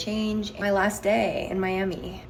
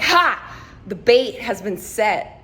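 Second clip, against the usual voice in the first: spring the trap with a triumphant burst, then draw it out; 2 semitones higher, 5 dB louder, slower than usual. Retold or imitated. Vlogger retelling her flirting win to viewers, triumphant.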